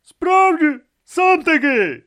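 high, loud retort; cutting comeback at a high pitch; sharp retort. Acted. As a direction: shout the insult straight back. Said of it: "Хтось із середини будинку відрізав".

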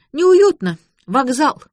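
high, loud critical verdict; girl's disapproval. A narrator's reading. Girl bluntly condemns the room.

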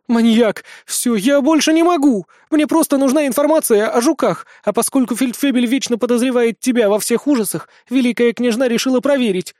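high, agitated outburst; blurted out. A narrator's reading. Witch confessing to her tormentor, blurting it out. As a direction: crack and spill it all in a rush of protest. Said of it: "выпалила Гертруда".

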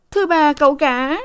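high, out-of-breath report; panting. A narrator's reading. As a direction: gasp it out, high. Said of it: "Sen hổn hển". (giọng hổn hển, cao)

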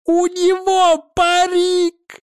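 high, gleeful drawn-out yell; exclaiming. Acted. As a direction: shout it out in glee. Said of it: "воскликнул он".

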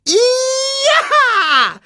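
sehr hoch und laut gerufen; Erdmännchenruf. A narrator's reading. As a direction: ein siegessicherer Angriffsschrei. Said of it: "rief das Erdmännchen wieder". (hoher, lauter Kampfruf)

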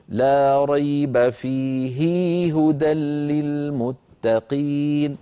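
فيه stretched into فيهي before هدى, chanted slowly; wrong recitation. Shown as a demonstration. Teacher chanting the wrong reading of فيه hudan. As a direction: chant فيه with a long added vowel. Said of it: "فلا نقول".

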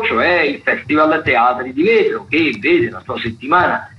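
ordinary talk, nothing marked

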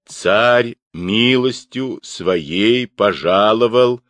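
ceremonial, drawn-out official reading; reading a proclamation. A narrator's reading. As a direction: proclaim it word by word. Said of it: "начал было читать похвальную грамоту".

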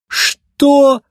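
high, loud indignant "what". A narrator's reading. Racer balks at road-work sentence, indignant. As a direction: blurt it out in outrage. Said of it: "возмутился гонщик".

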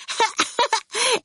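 put-on high little girl's giggle; childish giggle. A narrator's reading. Orphle amused by Torquil's joke, high giggle. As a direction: giggle at the tease. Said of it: "Orphle laughed".

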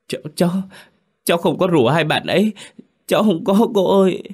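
high, loud, stammering crying plea; boy sobs to the mother that he didn't lead them; sobbing plea. A narrator's reading. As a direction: beg through sobs. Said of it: "Tôi sợ hãi, khóc nấc".

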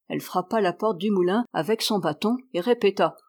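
ordinary delivery

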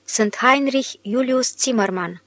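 ordinary delivery

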